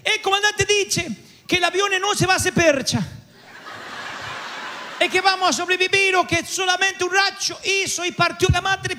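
high, soothing lady's voice with a laugh; calm him down like a kindly neighbour; Italian lady. Retold or imitated. The Italian lady reassures him, high and kindly. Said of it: "se para una señora y dice".

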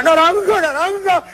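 high-pitched mock cries of passion; hammed-up high female voice; girl crying out. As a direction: cry out high like an excited girl. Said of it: "La chica gritaba".

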